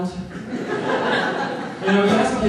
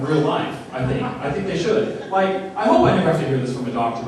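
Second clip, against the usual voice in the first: ordinary delivery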